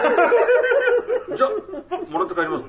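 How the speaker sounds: laughing squeaky parody voice; mock voice. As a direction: ham up a sly, cheerful taker. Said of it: "みたいな".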